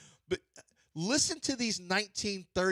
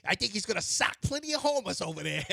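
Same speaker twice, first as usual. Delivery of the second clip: lightly imitating a thirties kid; a touch of old-time kid voice; 1930s kid voice; 8 semitones higher, 5 dB louder than usual. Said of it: "listen to some of the old phrases they use".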